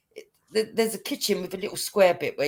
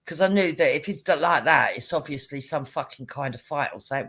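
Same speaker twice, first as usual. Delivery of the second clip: ordinary talk, nothing marked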